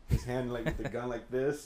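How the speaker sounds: ordinary delivery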